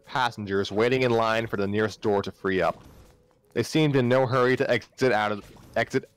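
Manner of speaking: plain reading voice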